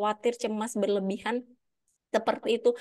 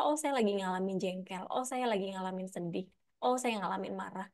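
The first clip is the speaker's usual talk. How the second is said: much softer, calm admission; accepting the feeling; 3 semitones lower, 8 dB quieter than usual. Pretend. Person gently admitting their annoyance to themselves, soft and calm. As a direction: quietly own each emotion. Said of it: "Kalau lagi jengkel nggak apa-apa".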